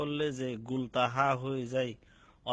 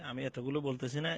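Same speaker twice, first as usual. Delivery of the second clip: ordinary talk, nothing marked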